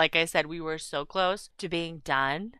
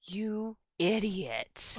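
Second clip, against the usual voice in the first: slow, soft, disgusted; muttered insult; 6 dB quieter, much slower than usual. Retold or imitated. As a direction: mutter it under your breath. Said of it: "I'm like".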